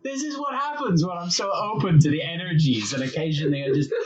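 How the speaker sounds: a hint of a mystic medium; mock medium voice. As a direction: lightly send up the medium. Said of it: "the media was like".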